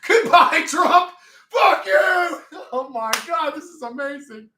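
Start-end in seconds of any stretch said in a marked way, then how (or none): 0.1-2.4 high, loud, laughing screamed insult; screaming fuck you. Pretend. Streamer voicing a man cursing Trump, high loud scream. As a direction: scream it at the departing president. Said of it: "He's like".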